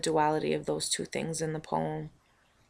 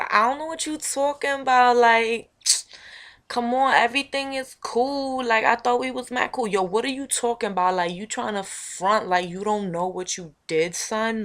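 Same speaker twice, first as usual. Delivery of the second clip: higher, louder playing-dumb voice; innocent former homegirl; 6 semitones higher, 8 dB louder than usual. The old homegirl denying everything, in a higher innocent voice. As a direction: act hurt and innocent. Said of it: "so she looks at me and she's".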